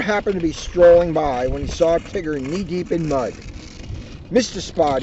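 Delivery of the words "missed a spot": usual speaking voice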